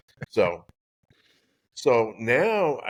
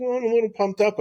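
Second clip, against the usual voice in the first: high, louder excited boast; mock pumped-up brag; 14 semitones higher, 4 dB louder, faster than usual. Golfer answering his caddie son, high mock excitement. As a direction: brag like you're buzzing with adrenaline.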